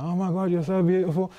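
lightly voiced gushing girlfriend; swerving girl. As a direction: gush it like the tipsy driver. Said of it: "She was swerving in the road like".

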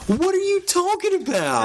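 sharp, high disbelief; outraged at a stranger's comment; incredulous. Real, not acted. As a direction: cry out in disbelief.

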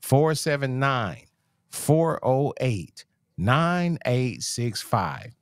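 phone number read out slowly, digit by digit; slow each number down so they can write it. Shown as a demonstration. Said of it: "I'm gonna say it slower".

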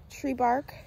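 ordinary delivery

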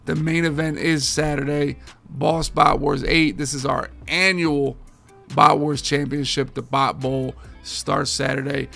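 ordinary talk, nothing marked

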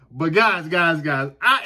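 ordinary delivery

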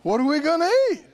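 loud, high, rushed panicky question; hammed-up fretting, fast and loud; tempted worry. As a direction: cry it out like mock alarm. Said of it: "What will you be tempted to say?".

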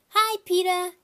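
put-on loud, high cheery kid greeting; gleeful toddler. A narrator's reading. Fudge surprising his brother, loud high cheery. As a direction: pop out delighted with yourself.

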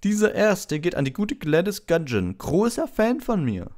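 high, loud, slow and self-satisfied; Lockhart voice. A narrator's reading. Lockhart boasts about a fan to Harry, high and pompous. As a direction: preen over your fan mail.

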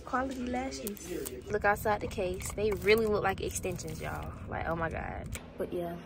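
hushed aside to the camera; soft-spoken. Real, not acted. Quiet ad aside about lashes.

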